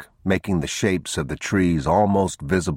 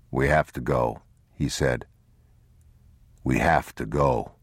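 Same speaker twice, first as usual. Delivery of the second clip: subdued father; 5 semitones lower than usual. Acted. Man gives up calling and tells boy, subdued. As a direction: say it low, with finality.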